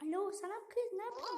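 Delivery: put-on soft high girly voice; girl voice. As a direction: falsetto girl calling her cousin. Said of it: "Kız sesi yapacağım". (ince kız sesi)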